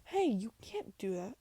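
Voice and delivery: acted protest, slow and loud. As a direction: object loudly, pitch swooping up. Character protests against the order.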